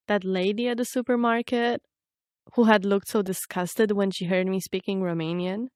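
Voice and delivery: ordinary talk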